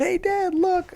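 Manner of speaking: put-on high-pitched child calling Dad; excited kid. Voicing his kids calling dad.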